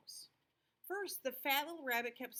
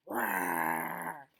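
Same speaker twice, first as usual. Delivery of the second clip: deep growling roar; lion roar; 7 semitones lower, 7 dB louder than usual. Acted. Lion roars in story.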